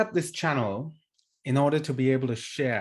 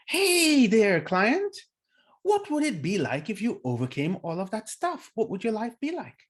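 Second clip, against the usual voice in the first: mock upbeat coach, pitched much higher; bright coach voice; 9 semitones higher than usual. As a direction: play the chirpy coach asking big questions. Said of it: "And then the coach goes".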